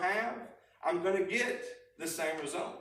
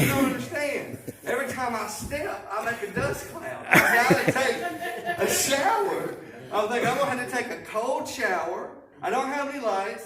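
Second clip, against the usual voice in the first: frantic inner panic; 6 semitones higher, 4 dB louder than usual. Retold or imitated. Retelling frantic thoughts, dirty, no power.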